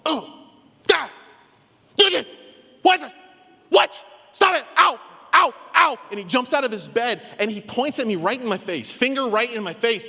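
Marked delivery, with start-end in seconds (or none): 0.1-6.0 sudden high yelps of pain, much louder; startled shouts of pain, jumping out of bed. Retold or imitated. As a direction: yelp like a wasp just stung you in bed. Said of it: "I hear this noise".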